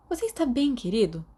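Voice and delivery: lightly voiced worried friend; concerned girl. A narrator's reading.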